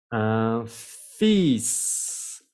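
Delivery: "un fils" slowly, a long "feess" with the S sounded; pronunciation demo. Shown as a demonstration. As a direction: stretch "un fils", silent L and hissed final S. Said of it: "esa palabra se pronuncia".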